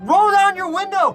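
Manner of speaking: high, loud imitated order; yelled demand. Angry driver to the car beside him, yelled demand. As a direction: yell it like an enraged driver.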